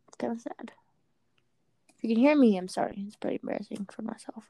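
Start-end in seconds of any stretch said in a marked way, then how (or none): 2.6-4.4 embarrassed whisper. Real, not acted. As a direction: whisper it to yourself. Embarrassed aside.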